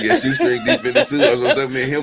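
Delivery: laughing through the words, pitch jumping up. Real, not acted. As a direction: giggle it out, high and breathless. Laughing about friend Shoestring.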